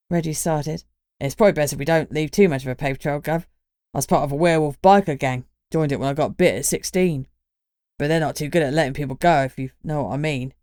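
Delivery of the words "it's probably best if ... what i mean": quick, confiding Cockney lad, lightly voiced; a fast, knowing Cockney aside; Cockney accent.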